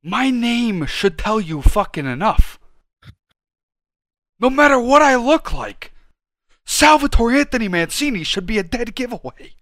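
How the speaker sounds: loud, high, drawn-out indignation; indignant rant. Real, not acted. Telling friend his name proves he's Italian, indignant. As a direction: proclaim it with offended pride.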